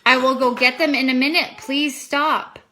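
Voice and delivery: firm plea. Real, not acted. Parent tells interrupting child to wait, firmly exasperated.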